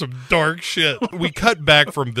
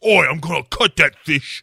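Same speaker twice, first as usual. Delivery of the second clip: mock Cockney threat; 3 semitones higher, 3 dB louder than usual. [name] voiced threatening fish.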